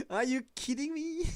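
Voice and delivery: half laughing, half yelling at the game; laughing disbelief. Real, not acted. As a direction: laugh it out in outrage. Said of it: "I'm laughing".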